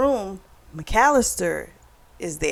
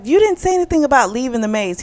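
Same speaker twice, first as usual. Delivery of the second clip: a touch higher and louder, rushed indignant retort; mock outraged protest; 5 semitones higher, 5 dB louder, faster than usual. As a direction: retell her protest with mild fast indignation. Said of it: "she freaked out".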